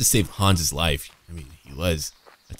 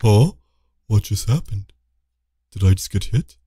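lightly voiced confused enemy, louder; dazed enemy; 4 dB louder than usual. Gamer voicing struck enemy, mild baffled voice. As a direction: play a dazed, baffled foe. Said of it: "He's like".

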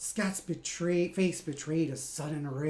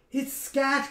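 high, louder starstruck cry; excited cry; 9 semitones higher, 4 dB louder than usual. Acted. Sprock recognises his hero, high excited cry. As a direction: gasp out the name like a fan. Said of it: "cried Sprock".

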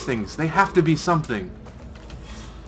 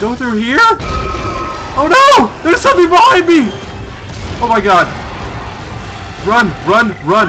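higher, louder frantic yelling; panicking gamer; 10 semitones higher, 10 dB louder than usual. Real, not acted. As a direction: scream while running away. Fleeing monster in panic.

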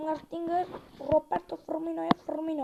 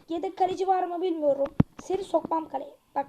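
ordinary talk

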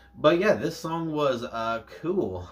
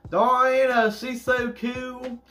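much higher, slowed fan squeal; excited gush; 12 semitones higher, 5 dB louder, slower than usual. Real, not acted. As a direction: gush about her like a starstruck fan. Gushing over the singer.

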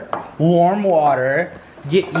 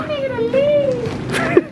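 mock wail; much slower than usual. Mimicking the store closing.

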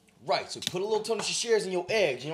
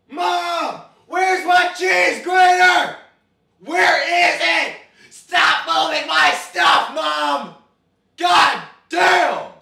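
high, loud, drawn-out yelling across the house; angry shout at mom; 10 semitones higher, 13 dB louder, much slower than usual. Real, not acted. Son yells at his mom about the missing cheese grater, furious shout. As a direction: bellow at mom to stop touching his stuff.

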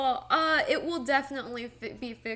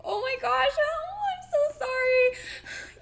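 high, slow hammed-up wail; hammed-up tearful sorry; mock wailing apology; 12 semitones higher, 7 dB louder, slower than usual. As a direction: wail it like a sinking ship. Mock sinking grief.

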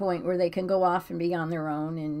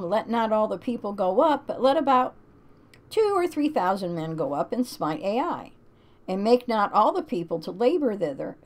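scripture reading; 3 semitones higher, 3 dB louder than usual. Acted. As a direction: read it like a solemn verse. Reads Bible passage aloud.

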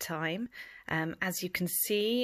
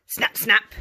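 snapping; 2 semitones higher, 9 dB louder than usual. A narrator's reading. Crocodile jaws snapping.

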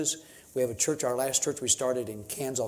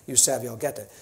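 lightly voiced Papua New Guinea pidgin; showing off his Tok Pisin pidgin; 3 dB quieter than usual. Shown as a demonstration. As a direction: rattle off a bit of pidgin as a demo. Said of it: "So I have that language down".

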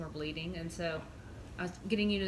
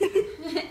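laugh; 9 semitones higher, 7 dB louder than usual. Real, not acted. Laughs after own impression.

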